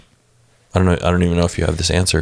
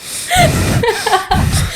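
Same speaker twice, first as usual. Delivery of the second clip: high-pitched burst of laughter; amused, high giggling fit; real laugh. Real, not acted. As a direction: crack up for a second or two. Both laughing at heavy breathing joke.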